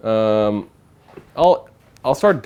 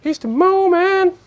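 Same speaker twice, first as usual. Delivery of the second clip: much higher, slow mock announcer; sportscaster voice. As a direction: hype it up like a sportscaster. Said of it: "I have to do a sportscaster voice".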